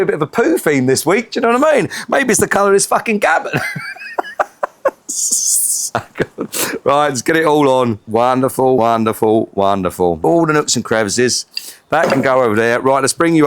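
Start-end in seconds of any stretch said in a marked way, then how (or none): 3.6-6.8 long high breathy laugh. Real, not acted. Cook laughs at the poo theme, long high laugh. 8.1-10.2 each wonderful lower, falling away. Real, not acted. Cook admires the glaze, each wonderful dropping lower. Glazing, repeated praise.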